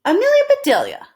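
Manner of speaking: high, loud recognition; Harry voiced. Harry recognizing Amelia Bedelia, high. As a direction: say her name knowingly. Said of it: "said Harry".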